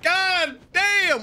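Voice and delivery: very high burst of amazement; real shocked exclamation. Real, not acted. Viewer stunned by a pirate's bounty, high shocked exclamation. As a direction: blurt it out high, astonished.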